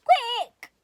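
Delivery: high, loud urgent cry. A narrator's reading. Owl crying out to his friends, loud and urgent. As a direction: sound the alarm. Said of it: "cried Owl".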